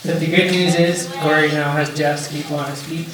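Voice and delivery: ordinary talk, nothing marked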